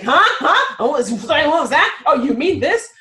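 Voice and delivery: high, loud, exaggerated huh with a laugh; mock confused listener. Coach mimicking people who dismiss accents, high and hammed-up. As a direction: ham up someone pretending not to understand. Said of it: "they're like".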